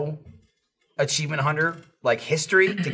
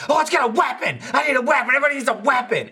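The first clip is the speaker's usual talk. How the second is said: high-pitched frantic shouting; freaking out; 8 semitones higher, faster than usual. Retold or imitated. Retelling the hammer scene to friends, freaking out. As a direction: imitate someone losing it, voice shot way up. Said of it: "Dennis freaks out".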